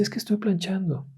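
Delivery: put-on high, soft little girl voice; a child explaining herself meekly; small quiet child. A narrator's reading. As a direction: daughter explaining innocently to mother, soft and high. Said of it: "Y la niña le dice".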